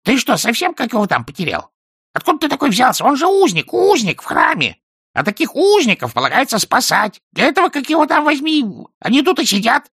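high, loud parrot rant; shrill-pitched, indignant talking bird; scolding parrot. A narrator's reading. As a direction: squawk a telling-off in a bird voice. Parrot scolding the wizard, shrieking.

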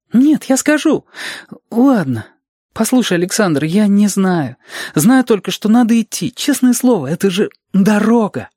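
put-on high, urgent child voice; earnest Reshka, giving in. Reshka confessing to Alexander after a scare, high child voice. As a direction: plead your case like a child, pitched high.

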